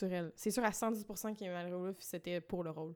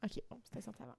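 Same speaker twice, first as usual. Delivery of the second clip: whispered aside, no voice at all; 13 dB quieter, faster than usual. Real, not acted. Co-host whispering a quick aside about the movie's year. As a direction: breathe it out like a secret.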